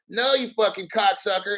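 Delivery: usual speaking voice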